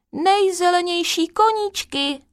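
high-pitched ordering call; mock order. A narrator's reading. Kryšpíček orders the greenest little horses, ordering. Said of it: "Kryšpíček poručil".

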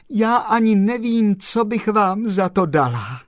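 high, loud gushing gratitude; overjoyed. Acted. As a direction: pour out thanks, elated. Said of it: "oči se jí zalily slzami radosti".

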